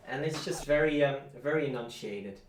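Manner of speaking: ordinary talk, nothing marked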